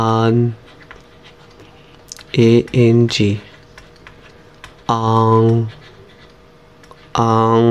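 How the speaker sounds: আ, আই, ও, আন, আং each said slowly and cleanly; pinyin finals drill. Shown as a demonstration. Teacher drilling students on pinyin finals আই, আন, আং. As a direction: model each Chinese final distinctly, one by one. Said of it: "এর উচ্চারণ হবে".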